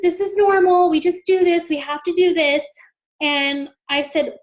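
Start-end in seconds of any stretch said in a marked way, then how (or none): 0.0-2.7 higher, louder imitation of insistent nurses; placating nurses. Nurses telling a mother it's routine, high placating voice. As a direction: mimic nurses brushing off a worry.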